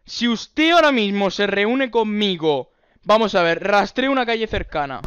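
ordinary talk, nothing marked

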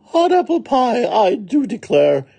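high, loud exclamation of pleasure; delighted great aunt. Acted. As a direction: declare it with excited relish.

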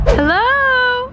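very high, loud hello; squealed hello; excited greeting. Real, not acted. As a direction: call out hello to a friend walking up. Greeting arriving friend.

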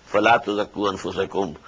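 melodic, measured tajweed chant; Quran recitation. Acted. Teacher recites the verse to his students, chanted tajweed. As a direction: intone the verse as in prayer.

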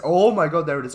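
loud, high burst of surprise; real startled outburst; startled exclamation. Real, not acted. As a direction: exclaim loudly at a sudden shock. Suddenly finds the way, falls.

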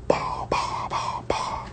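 soft unvoiced bah bah shots; whispered gunshot noises. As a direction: puff out the shots under your breath. Mimicking gunshots.

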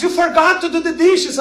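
shrill-free nagging shout, hammed up; yelling about the dishes; mock scream. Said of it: "every time she screams".

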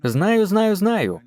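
hammed-up know-it-all brushing off advice; mocking know-it-all. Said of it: "а вони відповідають".

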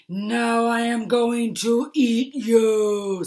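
slow, loud menacing bear snarl; a slow, loud bear snarl threatening to eat Jim. Acted. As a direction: loom over him and snarl. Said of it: "snarled the bear".

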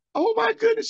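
very high, loud oh my goodness; amazed exclamation. Real, not acted. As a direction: burst out in amazement. Amazed at the call.

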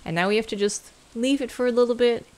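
ordinary talk, nothing marked

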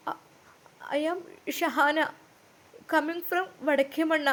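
slow, soft, shaky self-introduction; trembling nervous intro. A narrator's reading. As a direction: stammer it out, scared in front of the class. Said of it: "വിറച്ചുകൊണ്ട് അവൾ പറഞ്ഞു". (പേടിച്ചു വിറച്ചുകൊണ്ട്, പതുക്കെ)

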